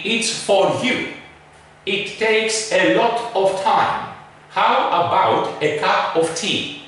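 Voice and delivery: full vowels on "for" and "of", slightly slow; wrong pronunciation. Shown as a demonstration. Teacher reading sentences the wrong way, full vowels. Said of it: "The wrong pronunciation would be".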